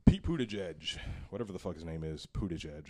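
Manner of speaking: normal talking voice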